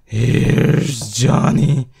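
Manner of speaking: hammed-up menacing call, lightly voiced; mock Shining taunt. Quoting The Shining again.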